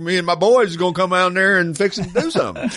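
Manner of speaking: put-on playful Southern good-old-boy accent; mock Southern accent. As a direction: tease a friend with a hint of Southern accent. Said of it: "you talk to J D Pass and you'll start saying".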